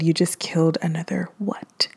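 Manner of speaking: hushed, breathless disbelief; shocked whisper. Retold or imitated. Niece whispers back to her uncle in shocked disbelief. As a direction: whisper it like you can't believe what you heard.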